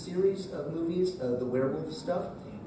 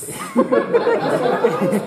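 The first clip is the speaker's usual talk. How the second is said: very high, loud squeal of joy; elated cheer; 13 dB louder than usual. Retold or imitated. Actor learns they will use his voice, elated squeal. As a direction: shriek it in triumph, far above normal pitch. Celebrating winning the Cutemon part.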